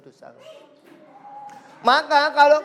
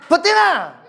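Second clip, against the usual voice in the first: high shouted taunt, louder; shrill schoolyard jeer; angry taunt; 8 semitones higher, 6 dB louder than usual. Retold or imitated. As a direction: yell the insult up high. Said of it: "Santoso bilangnya gimana?".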